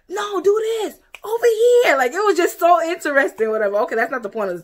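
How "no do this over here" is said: high shouted orders to workers; imitating men yelling. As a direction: bark the orders out high and loud, like pulling an anchor. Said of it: "you see these men yelling".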